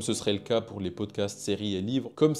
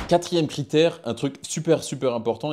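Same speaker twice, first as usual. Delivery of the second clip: higher and louder, eager new-section call; excited announcement; 5 semitones higher, 7 dB louder than usual. Real, not acted. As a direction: launch the new point with energy. Announcing the next criterion. (annonce enjouée, plus aiguë et plus forte)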